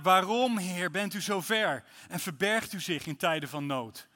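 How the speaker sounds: loud, high pleading psalm reading; psalm lament. Acted. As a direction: cry the question out to God. (luid klagend voorgelezen psalm)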